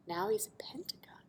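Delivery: gasp, then whisper it. Real, not acted. Reader showing the kids the picture, gasping whisper. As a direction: whisper in mock surprise.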